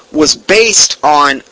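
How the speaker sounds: ordinary talk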